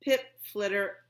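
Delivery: plain reading voice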